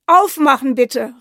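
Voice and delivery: lauter Ruf durch die geschlossene Tür; kräftig rufen, damit man ihn drinnen hört; laut gerufen. Acted. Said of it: "rief er". (Soldat ruft vor der Haustür laut, um Einlass zu bitten)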